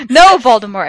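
high, loud acted protest; shouting back. A narrator's reading. Heroine refusing Voldemort's order, high loud shout. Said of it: "I shouted back".

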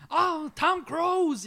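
high, slow gushing fan voice; Rosie O'Donnell impression. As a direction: swoon over it like a giddy talk show host. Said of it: "whenever she's like".

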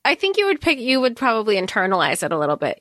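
ordinary talk, nothing marked

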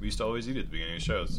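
talking through a mouthful of peanut butter; words muffled by a bite; mouth full of peanut butter. Real, not acted. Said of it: "J P just took a nice big mouthful of peanut butter".

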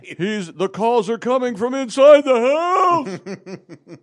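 high, drawn-out hammed-up yell; slow, piercing mock-alarm cry; mock horror-film warning shout. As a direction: yell it like a panicked movie warning, slowly.

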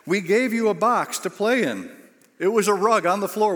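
exasperated telling-off. Retold or imitated. Camera operator scolds roaming preacher, exasperated. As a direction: spell it out to him in exasperation.

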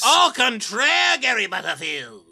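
booming villain reveal. Acted. Will bursts in as data miner.

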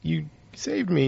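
mock the damsel gushing her thanks; gushing Lois. Lois thanking Superman after the rescue, mock gushing.

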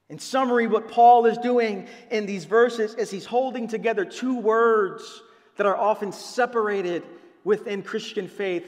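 ordinary talk, nothing marked

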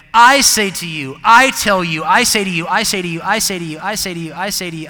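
starts booming, then fades back to plain voice; loud preaching declaration that fades with each repeat; repeated proclamation. Shown as a demonstration. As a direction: hammer the phrase loud, then let it wind down. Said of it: "your college paper was written like this".

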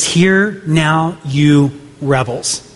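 usual speaking voice

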